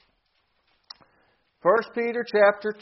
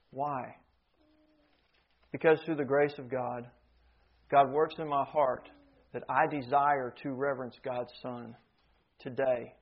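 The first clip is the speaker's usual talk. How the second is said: soft, low, reverent preaching; hushed testimony; 5 semitones lower, 6 dB quieter than usual. Real, not acted. Preacher testifies to God's grace in his heart, hushed testimony. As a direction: confide it to the congregation, softly and low.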